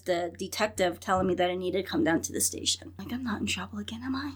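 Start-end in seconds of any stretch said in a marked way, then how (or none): 3.1-4.3 softer, unvoiced worried question; nervous whisper. Asking the detective on the phone, nervously whispering. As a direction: whisper it like you fear the answer.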